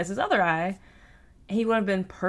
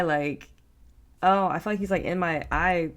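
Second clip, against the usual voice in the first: normal talking voice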